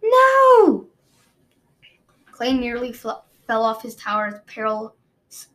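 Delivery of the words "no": high, loud desperate cry; anguished scream. Acted. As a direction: scream it out at full force. Peril bursts out in horror.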